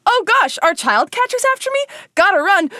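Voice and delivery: high, hammed-up panic; flustered and fleeing, played for laughs; mock panic. Host pretending to flee the child catcher, mock panic.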